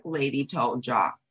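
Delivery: plain reading voice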